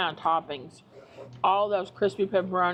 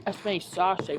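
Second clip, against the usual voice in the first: ordinary delivery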